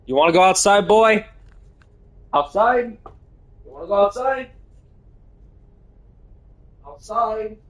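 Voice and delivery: high coaxing pet voice; sing-song dog talk, high and sweet; baby talk to a dog. Pretend. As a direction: coax the dog like a puppy. Talking to dog in game.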